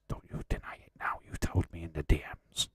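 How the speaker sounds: fast, conspiratorial whisper; whispered tease to a viewer; teasing whisper. Pretend. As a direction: whisper it like a shared secret. Teasing chat about secret crush.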